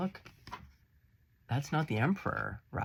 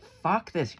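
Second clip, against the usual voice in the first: loud, higher mock outburst; defiant, raised imitation of Saint Catherine; loud defiant quote; 6 semitones higher, 7 dB louder than usual. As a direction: throw it out like a fed-up saint. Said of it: "She's like".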